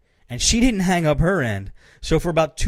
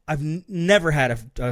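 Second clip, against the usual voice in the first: normal talking voice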